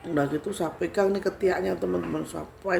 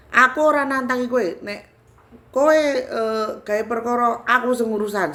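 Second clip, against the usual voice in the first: raised, loud confrontational retort; balasan tegas; 6 semitones higher, 4 dB louder than usual. Retold or imitated. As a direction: answer the challenge forcefully in Javanese. Acted retort in quarrel with spirit. (membalas menantang dengan suara keras)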